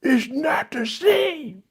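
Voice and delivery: high, slow, whiny protest; whiny high mock voice. Imitating Antonio whining to Donna. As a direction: stretch it out like a pouting man. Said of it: "And he was like".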